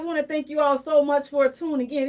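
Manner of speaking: normal talking voice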